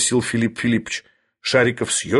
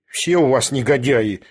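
usual speaking voice

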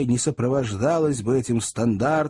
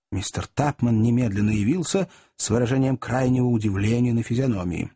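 ordinary delivery

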